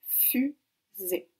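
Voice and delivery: "Fusée" découpé en deux, fu... sée, pour la dictée; détacher nettement les deux syllabes de "Fusée". Shown as a demonstration. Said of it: "Donc on écoute bien". («Fusée» dit syllabe par syllabe, avec une pause)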